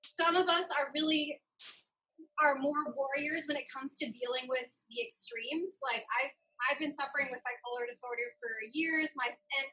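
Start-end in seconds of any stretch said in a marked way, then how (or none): none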